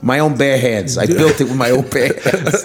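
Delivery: comic New York Italian wiseguy; Italian accent. Acted. Chinese noodle shop owner boasting of his work, Italian-American accent. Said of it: "you turn Italian".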